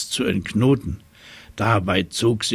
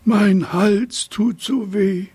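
high, slow, whiny complaint; whining giraffe; 11 semitones higher, 5 dB louder, slower than usual. Acted. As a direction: moan it like a sore-throated giraffe. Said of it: "Sie jammerte vor sich hin". (hohe, langsame Jammerstimme der Giraffe)

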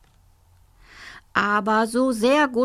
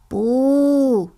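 trying a boo; 5 semitones higher, 5 dB louder than usual. Acted. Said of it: "versuchte es auch Ignaz".

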